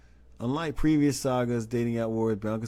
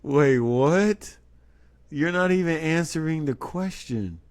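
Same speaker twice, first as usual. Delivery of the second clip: loud, high, drawn-out incredulity; loud disbelief; 7 semitones higher, 7 dB louder than usual. Acted. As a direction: call out the dodge, baffled. Incredulous at the spokesperson's dodge.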